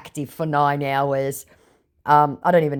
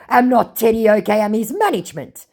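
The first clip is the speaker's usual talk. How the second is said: scammer voice; 7 semitones higher, 5 dB louder than usual. Retold or imitated.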